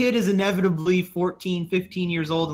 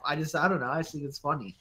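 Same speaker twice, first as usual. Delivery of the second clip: ordinary talk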